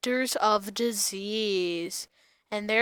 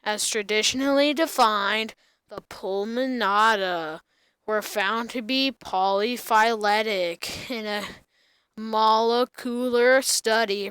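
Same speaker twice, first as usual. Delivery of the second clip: usual speaking voice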